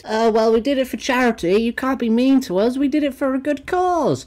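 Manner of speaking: high, whiny imitation of the charity singers; mocking whiny voice. Streamer mocking the singers' excuse, high and whiny. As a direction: mimic them in a sneering high voice. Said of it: "taking the piss".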